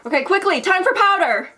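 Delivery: loud, high hype call; rally the viewers to contour; shouted like a hype host; hyped announcement. Real, not acted. Hyping up next step.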